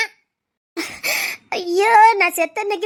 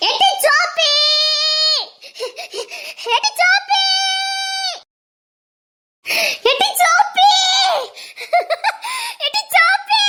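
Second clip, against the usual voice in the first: loud call; 9 semitones higher, 3 dB louder than usual. Acted. Grandmother yelling for Soppi from the street. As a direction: shout the name up the street, over and over. Calling girl from street repeatedly.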